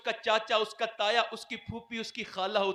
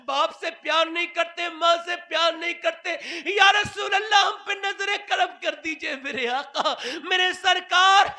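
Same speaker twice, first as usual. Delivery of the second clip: impassioned pleading; 5 semitones higher, 4 dB louder than usual. Acted. Pleading call at full force.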